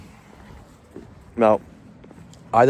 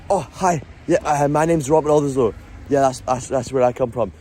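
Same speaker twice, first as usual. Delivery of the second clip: jumpy, nervous; 3 semitones higher than usual. Shown as a demonstration. Uneasy greeting that makes people uncomfortable, jumpy. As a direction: jump up in pitch and back off. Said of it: "a little bit jumpy".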